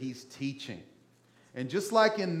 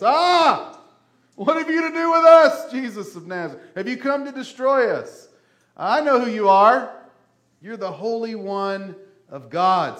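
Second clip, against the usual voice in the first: shrieking demon, much higher and louder; a possessed man's demon screaming at Jesus; demon crying out; 9 semitones higher, 13 dB louder than usual. Retold or imitated. Preacher voicing the demon crying out at Jesus, shrieking loud. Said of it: "cry out in a loud voice".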